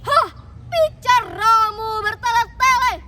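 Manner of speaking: booming, impatient royal rebuke; scornful king. A narrator's reading. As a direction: scoff hard and cut the seer down. King scornfully dismisses the seer.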